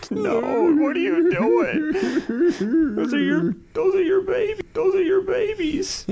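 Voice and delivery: high, slow, laughing mock wail; mock tearful wail. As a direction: wail it like a heartbroken kid, half laughing. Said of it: "it's just like".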